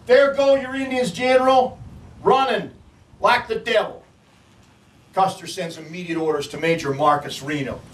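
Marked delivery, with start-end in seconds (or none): 0.1-4.0 high, urgent cry of the scout; screamed alarm. A narrator's reading. Scout warns the general of running Indians, screaming out. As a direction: call it out high, a frantic warning. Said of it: "He screams out".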